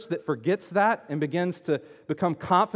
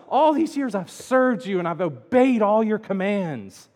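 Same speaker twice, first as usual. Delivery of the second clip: loud, higher-pitched aggrieved complaint; hurt; 4 semitones higher, 6 dB louder than usual. Retold or imitated. Older son complaining to his father about the party, hurt and indignant. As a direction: pile up the grievances, acted indignation. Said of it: "the son is so hurt".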